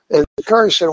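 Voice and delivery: usual speaking voice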